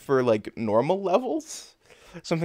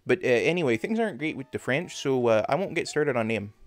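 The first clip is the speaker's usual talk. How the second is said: put-on thick Canadian hoser accent. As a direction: lay on the folksy Canadian accent, with "eh". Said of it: "have an accent like this".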